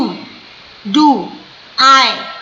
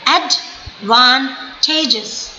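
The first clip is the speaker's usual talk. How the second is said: advantages very slow, ad... van... tages; slow syllables; 3 semitones lower, much slower than usual. Shown as a demonstration. Teacher demonstrating three syllables, advantages split slowly. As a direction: break advantages into three spaced beats. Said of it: "slow slow करके बोलिए".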